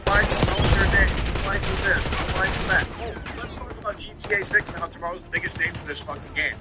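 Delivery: ordinary talk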